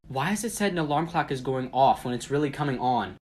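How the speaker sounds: ordinary talk, nothing marked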